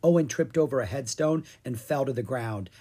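usual speaking voice

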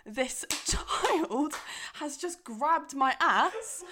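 laughing shocked half-whisper; shocked disbelief. Retold or imitated. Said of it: "I'm like".

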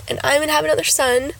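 ordinary delivery

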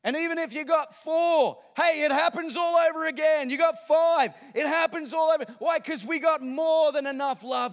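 high, loud, shouted pulpit build; fervent preacher climax; full-force preaching. Acted. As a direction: drive the point home to the congregation.

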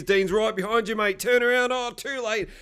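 high, teasing heads-up; mock urgent warning. Warning Heath Dean is behind him, high and mock urgent. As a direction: pitch it up like a running commentary.

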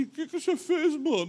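high-pitched mock reproach; retold upset outcry. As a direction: imitate a hurt lad demanding an answer. Injured young man confronts him, retold. (imitação de cobrança aguda e aflita)

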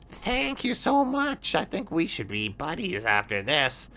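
high little scorpion befriending camel; grateful scorpion. Acted. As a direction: squeak your thanks warmly.

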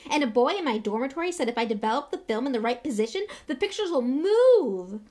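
put-on excited boy, loud and rushing; Colin Creevey. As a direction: race along, then stretch the last words in wonder. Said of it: "great shuddering breath of excitement".

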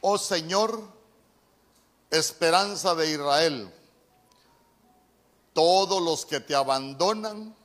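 loud, slow, declaiming preacher's reading; preaching scripture. Acted. Pastor proclaims Jeremiah to the congregation, loud and slow. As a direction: proclaim each phrase like a sermon. Reads scripture at full force.

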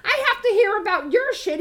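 high-pitched, indignant acted comeback; indignant retort. Sister arguing back at her brother on the phone, indignant. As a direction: push back in mock outrage.

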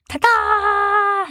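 high, showy ta-da; proud ta-da. A narrator's reading. Sniz shows off.

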